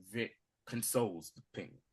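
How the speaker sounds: ordinary talk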